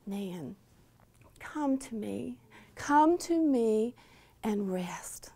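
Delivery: soft, hushed, drawn-out call, fading to breath; gently calling a weary woman to rest; sweet whisper. Retold or imitated. God whispering to a worn-out woman, sweet hush. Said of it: "I heard that sweet whisper once again".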